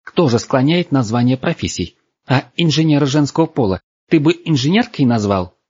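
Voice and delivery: high-pitched, pushy scolding; heated girl pressing the point; indignant rant. A narrator's reading. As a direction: lecture him in offended indignation. Angry girl presses her point.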